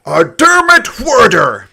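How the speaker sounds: put-on booming old orc addressing the crowd; grand proclamation, slow and loud; elderly orc announcer.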